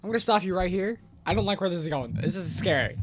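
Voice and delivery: ordinary talk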